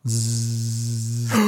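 long, low electric buzz; imitated buzz. Driver mimicking the device starting, drawn-out low buzz. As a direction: draw out a low buzzing zzz.

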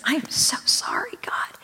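tearful whisper. Retold or imitated. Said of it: "I began to weep".

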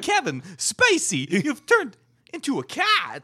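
slow, high-swooping character voice; hammed-up impression. Podcaster addressing a celebrity turned cat, hammed-up impression. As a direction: draw out the words as a theatrical announcement. Acted absurd line in put-on voice.

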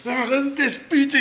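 mock urging. Urges friend to relax, laughing.

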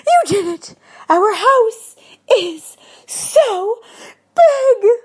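high, loud, stretched-out big; ecstatic scream. Acted. Wife thrilled at the big house, screaming high with joy. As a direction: squeal it with joy. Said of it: "she screamed".

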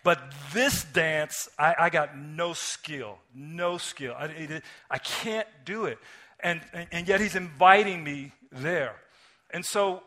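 loud, fervent sermon delivery; preaching at full force. Acted. Pastor preaching God's self-giving love to the congregation, loud and fervent. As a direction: preach it out to the whole room.

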